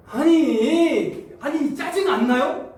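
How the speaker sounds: slow, drawn-out high, loud protest; irritated outburst. Acted. Preacher voicing annoyance at God's demands, exasperated outburst. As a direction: stretch it out in exasperation.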